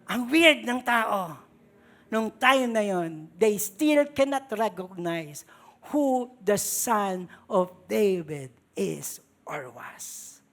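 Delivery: ordinary talk, nothing marked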